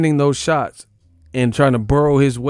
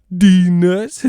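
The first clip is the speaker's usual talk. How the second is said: loud high-pitched punchline; hammed-up loud punchline; meme shout; 7 semitones higher, 8 dB louder than usual. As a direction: yell the meme at the camera.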